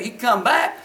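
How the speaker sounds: usual speaking voice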